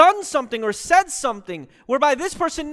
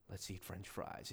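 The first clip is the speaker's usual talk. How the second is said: very low, hushed, weighty delivery; powerful simple words; 25 dB quieter than usual. Retold or imitated. Imitating a pastor who makes simple words powerful, hushed. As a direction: drop it deep and soft for gravity. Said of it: "make those simple words powerful".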